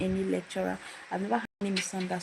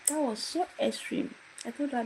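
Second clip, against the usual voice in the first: ordinary talk, nothing marked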